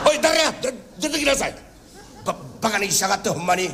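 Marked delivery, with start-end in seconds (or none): 0.1-1.6 a raised, testy call into the crowd; irritated shout. Acted. Master calls out to the mocking voice, irritated. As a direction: snap at the hidden heckler.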